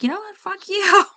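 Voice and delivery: high, loud and laughing as she says it; laughing defiant outburst. Retold or imitated. Telling off a complainer with a high, loud laughing retort. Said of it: "and it's like".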